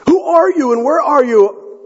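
acted cry to someone out of sight; calling up. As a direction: call up anxiously from a cliffside, pitched high. Jack hanging off cliff calls up.

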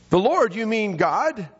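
high-pitched, incredulous climber; astonished question. Acted. Jack learning who is speaking to him, astonished question. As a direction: lift it high in disbelief.

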